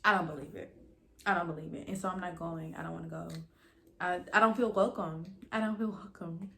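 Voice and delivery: ordinary delivery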